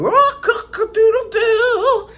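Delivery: high, slow rooster crow with a laugh. Shown as a demonstration. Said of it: "imagine you're a cocker or a chicken".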